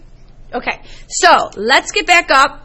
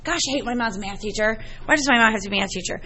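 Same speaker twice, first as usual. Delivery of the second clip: mock grumbling daughter; 4 semitones lower, 7 dB quieter, much faster than usual. Mother voices daughter's inner grumble.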